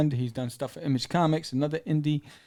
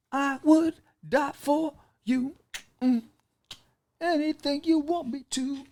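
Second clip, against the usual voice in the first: high, hammed-up swooning lover voice, a little louder and slow; 13 semitones higher, 4 dB louder than usual. Mock lover swooning to the woman he saved, high and slow. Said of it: "He goes".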